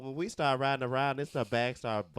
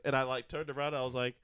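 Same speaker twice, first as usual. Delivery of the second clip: ordinary delivery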